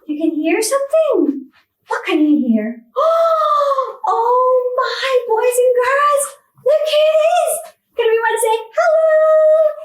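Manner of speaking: gasp, then build up the big reveal; excited. Acted. Surprise visitor arrives.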